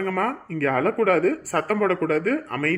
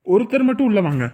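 loud, raised-pitch call; 5 semitones higher, 10 dB louder than usual. Acted. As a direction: call out to people waiting outside. Calls waiting relatives inside.

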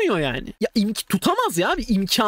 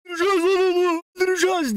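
mock bloodthirsty yell; 8 semitones higher, faster than usual.

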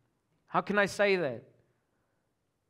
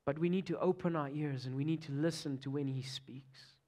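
intense whispered plea; hushed urgent sermon whisper; 3 semitones lower, 6 dB quieter than usual. Real, not acted. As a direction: drop to a breathy whisper to draw them in. Pleading softly with the congregation.

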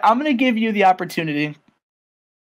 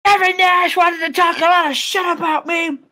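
a bitter rant about Kevin Nash; Scott Steiner; 11 semitones higher than usual. Retold or imitated. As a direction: complain like an angry big-ego wrestler. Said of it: "and he says".

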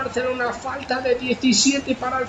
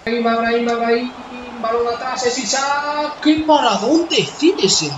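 play-by-play commentary; 3 semitones higher, slower than usual. Acted. Live play-by-play of match.

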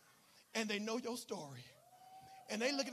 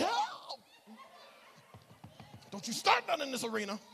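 mock alarm; 7 semitones higher, 5 dB louder than usual.

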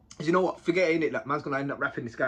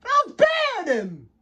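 much higher, louder, slow shout; fired-up mock yell; retold shout; 8 dB louder, slower than usual. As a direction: yell it out in outrage. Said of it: "I was thinking".

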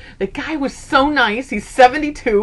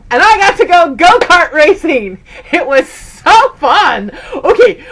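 bursting with excitement, higher and louder; excited; 8 semitones higher, 7 dB louder than usual. Real, not acted. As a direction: gush about the go-kart day. Excited about go-kart racing.

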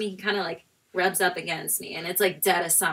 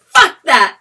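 loud, raised burst of defiance; defiant outburst; 5 semitones higher, 16 dB louder than usual. Retold or imitated. Woman turning back after the man, defiant outburst. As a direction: blurt it out loudly, fed up. Retold sudden change of mind.

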